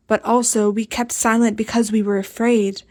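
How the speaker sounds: ordinary delivery, nothing marked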